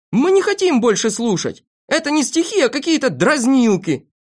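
high-pitched protest from the crowd; indignant shouting. A narrator's reading. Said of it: "закричали все". (возмущённые выкрики)

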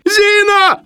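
extremely high, loud bawl; frightened yell. A narrator's reading. Frightened Sharikov yells for the maid, panicky bawl. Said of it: "орал испуганный Шариков".

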